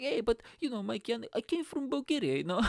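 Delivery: high, teasing Eastern European caricature; hammed-up Eastern European immigrant voice. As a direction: imitate mates mocking a Bulgarian accent. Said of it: "really stereotypical Eastern European accent".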